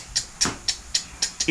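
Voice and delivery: soft unvoiced tick tick; breathy imitation of a ticking sound; whispered ticking noise. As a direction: tap out a clicking noise in a whisper. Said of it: "we hear this tick".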